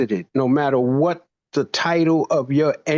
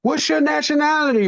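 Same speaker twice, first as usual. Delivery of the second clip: much higher mock-exasperated teacher; student dodges the question, speaker pushes back high and incredulous; retold exasperation; 11 semitones higher than usual. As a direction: play it like a teacher repeating himself. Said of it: "I said".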